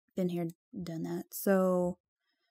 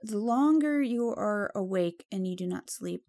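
ordinary talk, nothing marked

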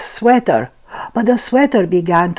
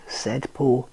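plain reading voice

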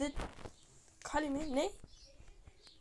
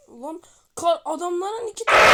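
much louder and higher, furious yelling; outraged shout; 8 semitones higher, 20 dB louder than usual. Real, not acted. Gamer raging at the opponents scoring twice, furious shout. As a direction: explode at the screen.

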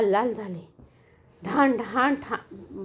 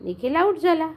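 ordinary delivery

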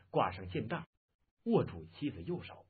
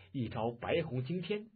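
usual speaking voice

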